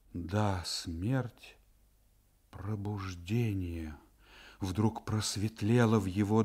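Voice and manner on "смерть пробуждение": plain reading voice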